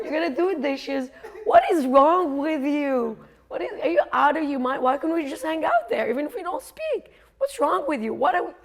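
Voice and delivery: high, loud, exasperated rant; yelling inner voice. Acted. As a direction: berate yourself like an outraged inner critic. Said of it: "yelling at me".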